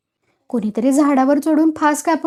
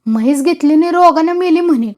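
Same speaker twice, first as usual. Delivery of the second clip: louder, higher sneering growl; 5 semitones higher, 5 dB louder than usual. A narrator's reading. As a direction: growl it out bitterly. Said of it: "तुका गुरगुरला". (गुरगुरत बोलणे)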